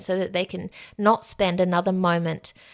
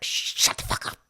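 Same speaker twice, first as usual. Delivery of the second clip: stunned whisper; 3 dB quieter than usual. Retold or imitated. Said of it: "and I was just like".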